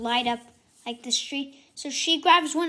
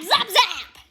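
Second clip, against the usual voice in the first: zapping sounds; 6 semitones higher, 7 dB louder than usual. Acted. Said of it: "it's like".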